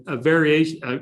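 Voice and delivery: normal talking voice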